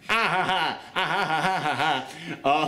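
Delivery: staccato "ha-ha-ha" cackle; mock villain laugh. Reviewer riffing on the villain as Mandark, staccato cackle. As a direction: laugh in even, pulsing bursts.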